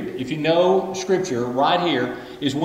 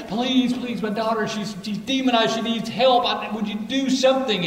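higher pleading voice; pleading mother; 7 semitones higher than usual. Retold or imitated. Mother pleading with Jesus for her daughter, higher voice. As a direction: beg Jesus for your daughter. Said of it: "begins to plead".

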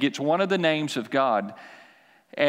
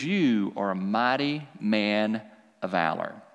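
voice of God; 6 semitones lower, slower than usual. Acted. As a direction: speak gently and slowly as God. God speaks to Gideon.

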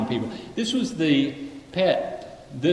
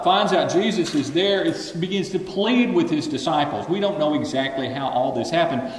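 ordinary delivery

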